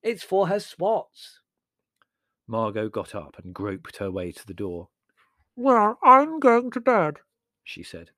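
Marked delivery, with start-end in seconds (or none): 0.0-1.4 high, slow, loud explanation; emphatic impatient roar. A narrator's reading. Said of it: "roared Prue". 5.5-7.2 high, loud, slow and smothered; garbled voice through a face wrapping; muffled by leaves. A narrator's reading. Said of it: "her voice muffled by cabbage leaves".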